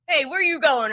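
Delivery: little voice. Retold or imitated. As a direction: mimic a friend's little voice from a window. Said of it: "In your little voice".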